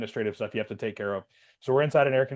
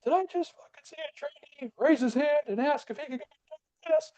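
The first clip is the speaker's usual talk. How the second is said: high squeaky mock instructor voice; shrill up the pitch like a cartoon instructor; mock drill instructor. Instructor grilling the trainee who wet himself, high mock voice.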